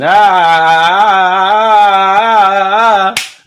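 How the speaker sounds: loud drawn-out groans of despair; frustrated groaning ahs. Real, not acted. Fan watching a player miss, loud groaning.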